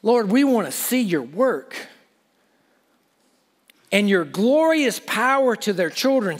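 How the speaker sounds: ordinary talk, nothing marked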